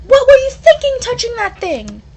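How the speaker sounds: a high, loud telling-off; furious scolding. Acted. Friend scolding the one who touched the cursed thing, furious. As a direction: scold them hard.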